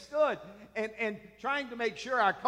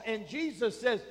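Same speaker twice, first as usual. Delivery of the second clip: ordinary delivery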